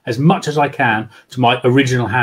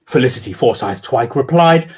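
ordinary delivery

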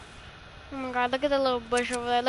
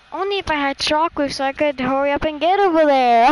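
loud teasing; 9 dB louder than usual. Real, not acted. Teasing teammate.

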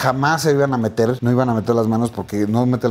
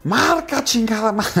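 high pitched furious yell at the referee; retold yell; 8 semitones higher, slower than usual. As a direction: bark the curse up high. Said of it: "me encabrono y le grito".

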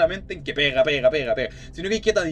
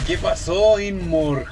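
normal talking voice

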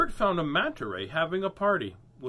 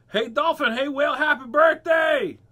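high, loud, excited holler; birthday shout; 13 semitones higher, 12 dB louder than usual. Acted. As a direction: shout the greeting to both at once. Turtle shouts greeting to both.

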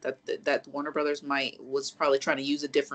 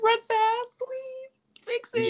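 mock desperate pleading; much slower than usual. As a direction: beg the hero on, voice pitched way up. Re-enacts pleading at the film.

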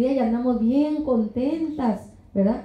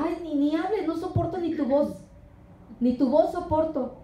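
scornful dismissal; 4 semitones higher than usual. Retold or imitated. Contemptuous person voiced.

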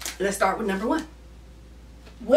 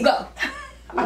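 laughing; 14 semitones higher, 4 dB quieter than usual. Real, not acted. Teasing, laughing at partner.

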